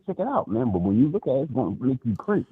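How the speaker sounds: usual speaking voice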